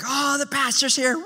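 hammed-up excited middle schooler; middle-school excitement. Middle schoolers greeting their pastor, mock high-pitched excitement. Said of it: "you see that excitement".

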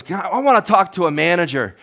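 high, loud mock demand; insisting on the manager; imitating a customer demanding the manager. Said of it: "And finally goes".

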